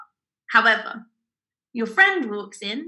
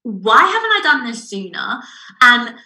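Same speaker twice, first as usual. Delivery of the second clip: eureka moment; 5 semitones higher, 7 dB louder than usual. Retold or imitated. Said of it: "has a eureka moment".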